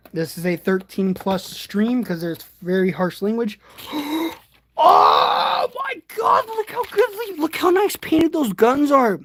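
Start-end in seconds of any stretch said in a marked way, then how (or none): 3.8-4.3 sharp excited gasp; amazed gasp. Real, not acted. Sees the painted guns. 4.8-9.2 high, loud excited squeal; thrilled amazement. Real, not acted. Thrilled by the painted guns.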